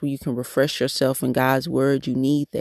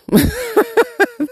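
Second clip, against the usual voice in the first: bright burst of real laughter; 4 dB louder than usual. Real, not acted. Laughing at how she talks, a real laugh. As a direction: laugh out loud at yourself. Laughs at her own talking.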